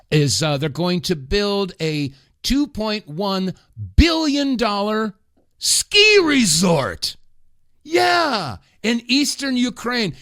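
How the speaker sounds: scoffing, disbelieving emphasis; incredulous outrage. Real, not acted. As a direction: stretch ski resort out like it's absurd. Outraged at Ukraine ski resort spending.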